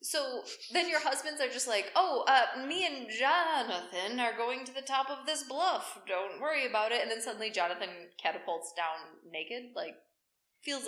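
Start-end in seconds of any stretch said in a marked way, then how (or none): 2.0-7.0 casual husband excuse. Acted.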